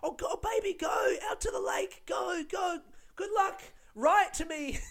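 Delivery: mock-tearful high-pitched farewell; hammed-up falsetto sweetheart waving a soldier away; war send-off. Woman sending him off to war, hammed-up high send-off. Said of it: "she's sending him off to war".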